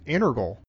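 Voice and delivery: "integral" slurred to INNER-gul, no T; sloppy pronunciation. Shown as a demonstration. Citing a sloppy version, "integral" as INNER-gul. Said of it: "that's just sort of sloppy speech".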